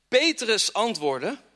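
usual speaking voice

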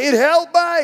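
a mother crying out to God; desperate plea. Acted. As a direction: beg the Lord a second time, harder. Woman pleading again.

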